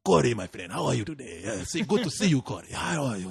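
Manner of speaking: put-on foreign-accented, over-friendly greeting; Rudy. Janitor greets the student he likes, foreign accent. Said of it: "guys with accents".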